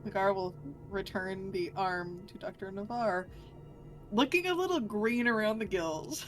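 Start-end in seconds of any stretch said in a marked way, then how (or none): none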